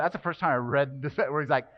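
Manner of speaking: ordinary talk, nothing marked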